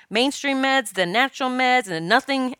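ordinary delivery

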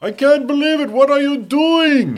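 very high, slow, piercing falsetto shriek; foreign neighbor's voice. Retold or imitated. Neighbor yelling at his bratty kids upstairs, shrill falsetto. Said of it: "the voice like".

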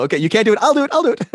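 high, mock-eager push to the front; pushy eager singer. Auditioner shoving others aside, mock-eager. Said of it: "they'll push you out of the way, go".

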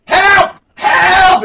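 very high, loud imitation yell; mock cries for help. Mimicking panicked contestants to friends, mock shouting. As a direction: yelp it like a hammed-up drowning swimmer. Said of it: "they're like".

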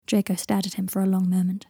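usual speaking voice

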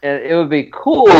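sudden excited burst on "cool"; really loud. Real, not acted. Hoping for Green Lantern, real loud excited outburst. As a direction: let the excitement blurt out loudly. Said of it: "Sorry, I got really loud".

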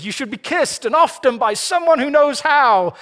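badly faked old Hollywood American accent; attempted American accent. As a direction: put on a big American movie voice. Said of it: "gonna do my American accent".

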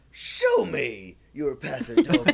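drawn-out, high mock-dramatic command; hammed-up film quote, slow and high; imitating film character. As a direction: stretch the movie line out theatrically, pitched well up. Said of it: "and he says".

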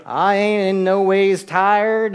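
slow, loud mock Southern accent; corny southern accent. Politician pandering to Southern voters, corny southern accent. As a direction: drag it out like a pandering fake Southerner. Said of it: "some corny southern accent".

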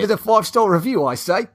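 high-pitched hammed-up Cockney; playful fake British, Cockney style; mock Cockney. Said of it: "You gotta do Cockney".

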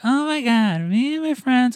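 breathless excited squeal; excited girl voice. Retold or imitated. Imitating an excited date.

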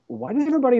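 high, wondering question; puzzled questioning. Retold or imitated. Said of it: "And then I started questioning".

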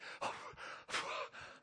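imitated gasps on waking; panicked gasping. Waking from the bear dream, acted panicked gasps. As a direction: gasp for air as if jolted awake. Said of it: "can't hardly breathe".

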